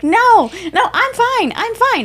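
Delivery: very high, loud, fast protest; anxious deflecting. Retold or imitated. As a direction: brush off the care in a high panic. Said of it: "my whole body be like".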